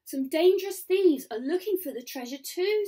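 put-on high, loud, animated girl; urgent warning. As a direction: warn them with alarm. Girl warns of thieves.

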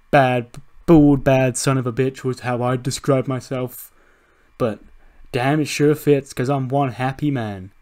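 loud, pleased self-introduction; gloating wrestler promo. Acted. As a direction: boast loudly to the crowd. Acts happy wrestler on the mic.

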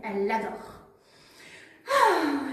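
plain reading voice

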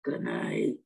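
very low sleepy-husband goodnight; low-pitched reply from the husband; husband's voice. A narrator's reading. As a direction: drop way down for the husband. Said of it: "says her husband".

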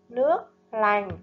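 Nước lành with a clear L at the start of lành; careful pronunciation. Shown as a demonstration. Teacher modelling Nước lành, clear L sound. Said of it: "chú ý phát âm ở âm L trong tiếng lành".

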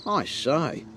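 high, loud exclamation with a touch of amusement; amused. A narrator's reading. Mister Happy reacts to a rude neighbour, mildly amused. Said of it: "laughed Mister Happy".

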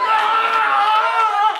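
long high-pitched mock cry; hammed-up kindergarten tantrum wail; childish wail.

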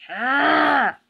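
growled fighting yell; battle cry. Acted. Engine charging at James, battle cry. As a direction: let out a roar as you charge.

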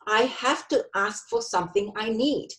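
normal talking voice

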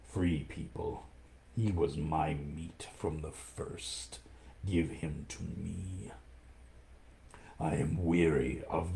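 low, slow, soft hissing growl; menacing tiger. Acted. Shere Khan demands the man cub, low, slow and menacing. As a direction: savour each threat like a hungry tiger.

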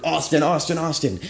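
high-pitched imitation of her calling out; mock scream. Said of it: "ran up to me screaming".

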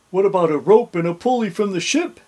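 quick, high, loud suggestion; Wavy. A narrator's reading. Pirate to captain at the jail, quick high voice. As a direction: pipe up hurriedly with an idea. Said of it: "said Wavy".

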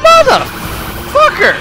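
high strained furious cry, drawn out; real gamer rage; angry outburst. Real, not acted. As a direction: rage at the rammer. Raging at other drivers.

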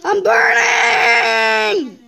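long loud cry of alarm; drawn-out yell. Pretend. Player catching fire in the game, drawn-out yell. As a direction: yell it out, stretching the word right out.

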